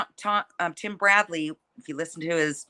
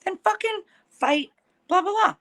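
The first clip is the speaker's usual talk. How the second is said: high, taunting mock voice; 13 semitones higher than usual. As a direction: toss it off in a high sneer. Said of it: "I went to her page and I go".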